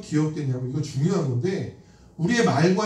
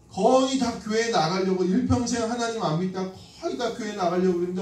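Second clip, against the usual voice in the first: ordinary talk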